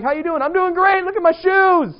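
high, loud, preening voice; mock showing off. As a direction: lampoon a vain churchgoer bragging. Mocking showy churchgoers.

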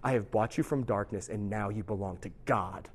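low, quiet, solemn pronouncement; grave, hushed. Retold or imitated. Priest grabs the convict to claim him for God, grave and hushed. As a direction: deliver a priest's charge low and softly.

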